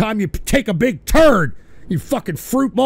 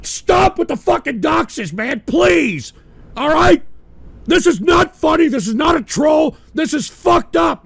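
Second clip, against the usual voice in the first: angry shouting; 5 semitones higher, slower than usual. Real, not acted. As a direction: yell at them to stop. Furious at trolls doxing.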